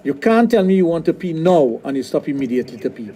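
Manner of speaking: ordinary talk, nothing marked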